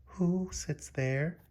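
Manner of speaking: soft, scared, drawn-out whisper. Acted. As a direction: whisper it slowly, a little afraid. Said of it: "whispered Julie".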